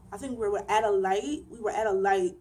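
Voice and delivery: usual speaking voice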